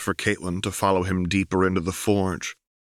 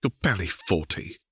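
acidly. Acted. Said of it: "Caitlin replied acidly".

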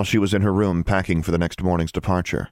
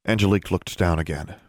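usual speaking voice

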